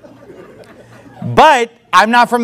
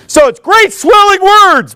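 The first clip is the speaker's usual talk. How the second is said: high, louder, slow mock orator voice; mock grand preacher; 12 semitones higher, 5 dB louder than usual. Mocking empty preachers, high and grandiose. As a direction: ham up the swelling words in a high voice.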